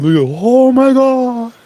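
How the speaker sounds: high, slow, drawn-out gasp of horror; pearl-clutching Oh my God, stretched high; mock shock. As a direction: play a scandalised onlooker.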